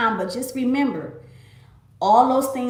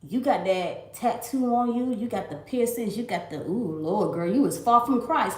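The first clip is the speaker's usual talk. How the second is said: mock judgmental Pharisee; 2 semitones lower, 7 dB quieter than usual. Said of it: "a Pharisee will look at me and be like".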